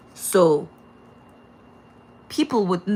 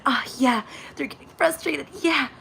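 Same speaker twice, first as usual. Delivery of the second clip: sly satisfaction; 6 semitones higher than usual. Retold or imitated. Neighbors savoring your frustration.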